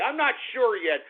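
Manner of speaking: ordinary talk, nothing marked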